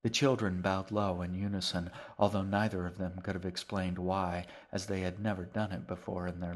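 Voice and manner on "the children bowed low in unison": plain reading voice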